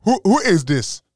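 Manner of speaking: loud, high mock outburst at the phone; mock shout. Daughter yelling at dad who answers the phone oddly, loud mock outrage.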